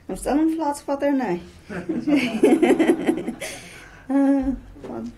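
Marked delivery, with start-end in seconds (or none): 1.4-4.0 loud rising laugh at herself; hearty laugh, rising. Real, not acted. Laughing about still in flats.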